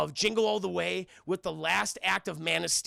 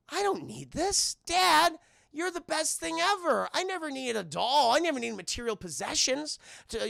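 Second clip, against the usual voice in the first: high, earnest mock-child voice; imitating a kid; 7 semitones higher than usual. Son tells dad he needs no doll, mock-earnest child. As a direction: gush like a little boy adoring his dad. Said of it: "And the kid goes".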